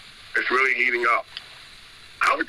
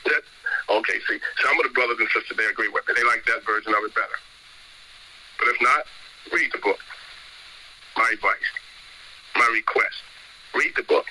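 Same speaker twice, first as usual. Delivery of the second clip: ordinary talk, nothing marked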